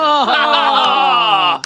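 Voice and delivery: huge drawn-out howl of disbelief; shouted reaction. Real, not acted. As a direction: holler at the terrible dice roll. Table reacts to another rolled one.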